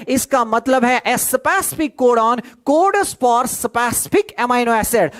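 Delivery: slow, spelled-out dictation pace. Shown as a demonstration. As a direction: give the definition word by word for notes. Teacher dictates definition slowly.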